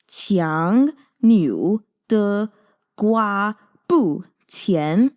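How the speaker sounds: qiang niu de gua bu qian, each syllable drawn out very slowly; saying it slowly. Shown as a demonstration. Teaching viewers a Mandarin saying, very slow. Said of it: "I'm gonna say it slowly".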